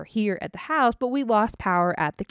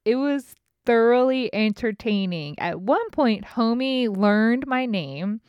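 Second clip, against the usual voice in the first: ordinary delivery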